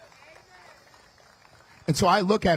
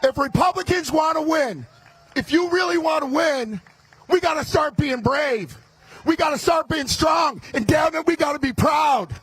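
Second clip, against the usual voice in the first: fiery rally speech; 7 semitones higher than usual. Acted. Candidate firing up the Republican crowd, shouting. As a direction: fire up the crowd at full volume. Rallies the crowd at full force.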